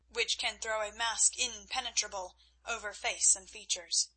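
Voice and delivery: ordinary delivery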